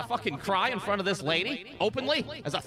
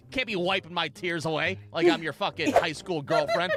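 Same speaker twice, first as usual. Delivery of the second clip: ordinary talk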